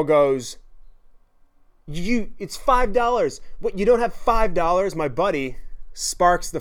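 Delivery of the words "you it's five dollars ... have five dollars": put-on drunk demand. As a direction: drag it out like a tipsy bully. Drunk girl demanding money.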